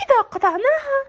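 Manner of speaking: high, fast, indignant outburst; angry cry. A narrator's reading. Girl warning villagers, fast angry cry. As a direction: scold the village in anger. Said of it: "صرخت فتاة بغضب".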